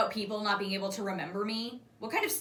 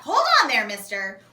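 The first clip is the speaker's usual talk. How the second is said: mock stern call; 11 dB louder than usual. A narrator's reading. Yanks him back.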